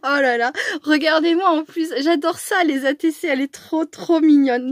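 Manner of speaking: loud, high, squealing delight over a gift; overexcited gushing. Real, not acted. Crafter gushing over a friend's card, loud and overexcited. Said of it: "Bon, je me calme".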